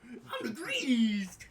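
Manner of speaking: loud, high, slow cartoon Uncle Sam; hammed-up Uncle Sam bellow. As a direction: boom it out like a goofy patriot. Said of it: "that's what his voice is now".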